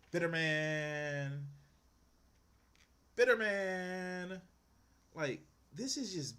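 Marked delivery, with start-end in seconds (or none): 0.1-1.5 very slow, drawn-out mock label in an odd voice. Judging the man in the clip, a slow drawn-out mock. Mocking the clip's speaker. 3.1-4.4 very slow and a bit higher, pressed harder; drawn-out verdict. Pretend. Repeating his verdict on the clip, slow and emphatic.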